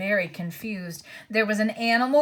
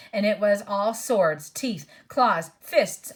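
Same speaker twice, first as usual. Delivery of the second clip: ordinary delivery, nothing marked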